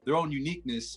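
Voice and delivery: ordinary delivery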